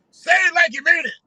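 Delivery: high, loud preacher shout; mock preacher exhortation; preacher call. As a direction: demand it from the crowd. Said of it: "So Al can switch it up".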